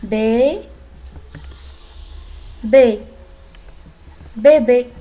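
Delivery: teacher sounding out syllables. Shown as a demonstration. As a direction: sound out bé, bé, then say bébé. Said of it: "lire en syllabes".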